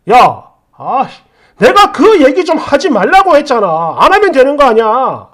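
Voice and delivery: loud, angry outburst; acted shout of frustration; exasperated husband. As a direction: lose patience and tell them off. Acted husband losing temper.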